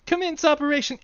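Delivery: high, loud, hammy general; silly general voice. Acted. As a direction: play a pompous squeaky general. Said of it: "Baby plus General plus [name]".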